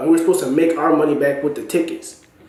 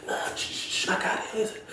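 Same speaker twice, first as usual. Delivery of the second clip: unvoiced shh, dismissive; hushing whisper; 6 semitones higher, 13 dB quieter than usual. Friend hushing the narrator in the car, whispering. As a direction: hush him, I got this.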